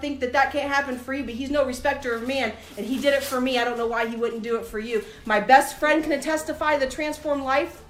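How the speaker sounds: usual speaking voice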